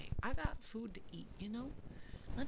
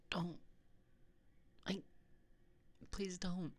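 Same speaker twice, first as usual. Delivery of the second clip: small, halting pleas; mock pleading; 3 semitones lower, slower than usual. Streamer keeps begging chat not to do it, mock pleading. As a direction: beg piece by piece, with long hesitations. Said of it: "Please don't make me beg".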